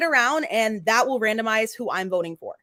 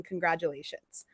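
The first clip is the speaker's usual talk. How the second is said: soft unvoiced whisper; 6 semitones lower, 10 dB quieter than usual. Real, not acted. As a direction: breathe it out grudgingly. Grudging congrats after complaint.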